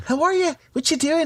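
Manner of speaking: very high, put-on Irish lilt; Irish accent. Showing the accent his wife hates, high hammed-up Irish. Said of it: "She hates the Irish one".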